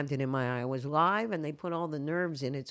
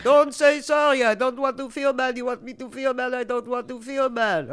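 angry Israeli; 6 semitones higher, 8 dB louder than usual. Retold or imitated. Stranger scolding the Canadian loudly for apologizing. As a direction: lecture her like an annoyed stranger. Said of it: "And he's like".

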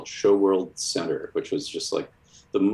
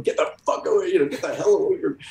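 high, mock angry brush-off; Ditko snapping; 12 semitones higher, 3 dB louder, faster than usual. Ditko slamming the door on the fan, mock angry. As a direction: shoo the fan away as the door slams. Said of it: "he slammed".

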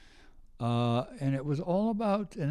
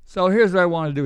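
excited pitch; 8 semitones higher, 13 dB louder, faster than usual. Retold or imitated. Pitching idea to producer excitedly.

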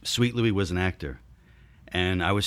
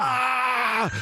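pained yell. Retold or imitated. Injured dancer cries out.

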